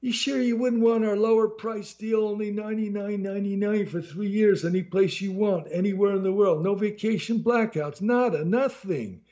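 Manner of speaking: put-on high, pushy New Jersey salesman accent. A narrator's reading. Mafia-type salesman pushing a cheaper deal in a New Jersey accent. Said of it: "his low-class New Jersey accent".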